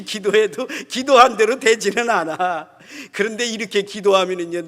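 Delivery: loud, high impassioned sermon; fiery preaching. Acted. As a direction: preach it out at full force. Preaching at full force.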